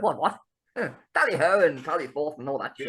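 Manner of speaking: lightly voiced upper-class toff; a hint of plummy posh British; posh English accent. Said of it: "I haven't got my pinky up".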